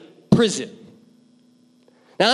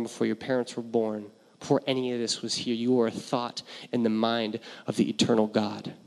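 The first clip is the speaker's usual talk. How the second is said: hushed preaching; 9 semitones lower, 6 dB quieter than usual. Acted. Pastor inviting the congregation to focus, hushed and soft. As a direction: draw the room into quiet awe.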